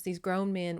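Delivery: ordinary delivery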